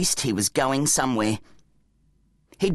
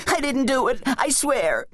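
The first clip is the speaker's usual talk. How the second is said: panicked denial; 10 semitones higher than usual. Acted. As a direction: plead innocence in a high whine. Bum panicking, denying blame.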